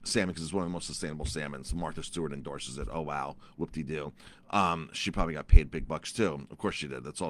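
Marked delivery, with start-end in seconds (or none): 2.9-4.1 low, soft deadpan sarcasm; muted mock amazement; sarcastically unimpressed. Real, not acted. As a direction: drop it low, couldn't care less. Sarcasm about celebrity endorsement.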